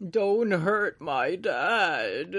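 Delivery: put-on slow, drawn-out eerie little girl voice; pitiful chimera girl. Nina chimera voiced in recap.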